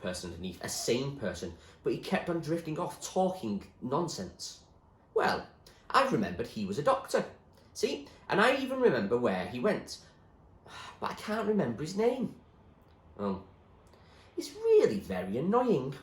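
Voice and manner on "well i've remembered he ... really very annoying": put-on raised boyish voice, a touch annoyed; William, vexed. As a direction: grumble about forgetting. William frets over memory.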